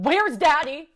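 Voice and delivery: loud, high, urgent question; mother screaming. Retold or imitated. Mama screaming for the missing father. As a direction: cry it out over the storm. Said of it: "Mama screaming".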